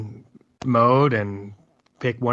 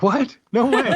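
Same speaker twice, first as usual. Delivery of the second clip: much higher, louder disbelieving cry with a laugh; stunned coworkers; 12 semitones higher, 5 dB louder, slower than usual. Retold or imitated. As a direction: play the coworkers gasping in disbelief. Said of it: "they're like".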